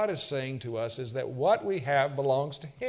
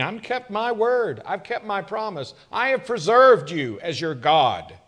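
loud, high, fervent pulpit voice; a preacher building to full force; full-force preaching; 5 semitones higher, 9 dB louder than usual. Acted. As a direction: preach it out to the whole room.

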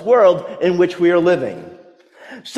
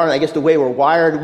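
ordinary talk

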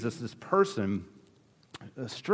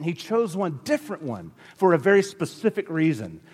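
ordinary talk

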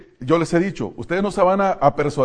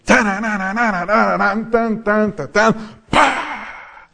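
higher and louder mock rock riff; imitated rock intro; 8 semitones higher, 4 dB louder, slower than usual. Pastor's entrance music, mock rock riff sung out. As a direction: hum a rock intro with a hit at the end. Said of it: "le pone una música de rock de introducción".

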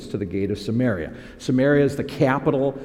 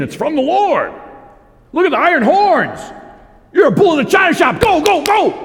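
much higher, loud and fast cheering; mock hype; 6 dB louder than usual. Preacher mimicking demonic prophets egging Ahab on, high shouting. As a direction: yell them on like a hype man.

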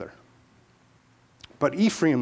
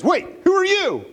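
startled challenge; 13 semitones higher than usual. Acted. Philistine guard challenges intruder.

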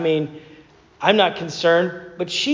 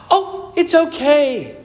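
higher, slow sing-song ad voice; higher, slowed, mock-soothing commercial read; 8 semitones higher, slower than usual. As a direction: ham up a soothing TV ad parent. Mimicking a cheery paper towel commercial.

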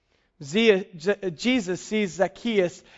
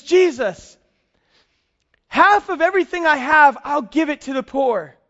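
higher and louder, a joyful outpouring; overwhelmed with joy; 6 semitones higher, 4 dB louder than usual. Retold or imitated. Tax collector overflowing with joy as he answers Jesus, joyful. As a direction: let the joy burst out as he gives half away. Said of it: "just sense of joy that he feels".

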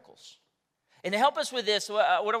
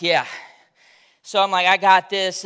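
cocky self-talk; 11 dB louder than usual. Retold or imitated.

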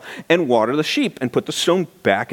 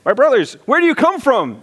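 much higher, louder eager hello; enthusiastic greeting; 11 semitones higher, 3 dB louder than usual. Retold or imitated. Jacob greeting the shepherds, eagerly higher. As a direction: greet strangers like long-lost friends. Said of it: "I could just see the enthusiasm".

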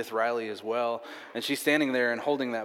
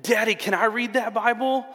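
high, loud imitation of an excited child; eager young daughter; 11 semitones higher, 7 dB louder than usual. Daughter asking her daddy for his Bible, eager. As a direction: plead like a little kid. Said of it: "she looked up at me and she goes".

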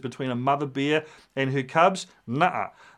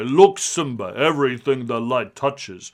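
lower, slow, kingly Mufasa impression; 6 semitones lower, slower than usual. Mufasa telling Simba about his kingdom, low and slow. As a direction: rumble it out like a lion king. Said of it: "and Mufasa says".

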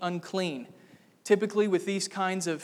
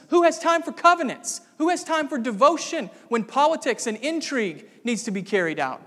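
loud, higher and scornful; scornful shout; 7 semitones higher, 6 dB louder than usual. Acted. Preacher mocking Saul's priorities, scornful raised voice. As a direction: sneer at the very idea of covenants.

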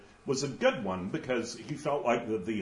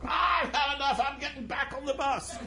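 high, whiny cry of giving up; huffy ghost; 14 semitones higher than usual. Retold or imitated. Ghost quitting heaven for the bus, high and tearful. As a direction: storm off in a high, tearful huff. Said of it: "the guy's like".

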